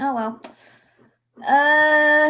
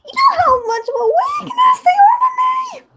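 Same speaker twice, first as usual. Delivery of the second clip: high, loud, laughing wail; hammed-up laughing cry; laughing shout; 5 dB louder than usual.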